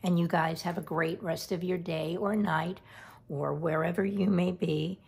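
ordinary delivery

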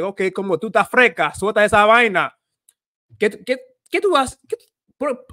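sassy Dominican scolding; shadowing a sassy Dominican woman. Pretend. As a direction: play the bossy Dominican lady telling someone off. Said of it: "I do, like, a lot of shadowing".

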